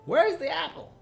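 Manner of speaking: very high, loud squawk of disbelief; escalated comic demand; mock exasperated outcry. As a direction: cry out in hammed-up bafflement. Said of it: "and you're like".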